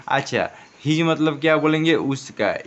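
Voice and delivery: ordinary delivery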